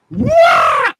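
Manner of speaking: loud high "wah" of falling; imitated yell. Husband acting out the carpet pulled from under him, loud yell.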